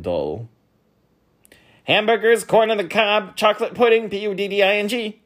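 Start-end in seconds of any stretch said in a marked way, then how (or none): 1.8-5.2 high, loud, quick bee character; spelling bee voice. A narrator's reading. Spelling bee calling out his banquet order, high and loud. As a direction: rattle it off high and loud, spelling the last word. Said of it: "said the spelling bee".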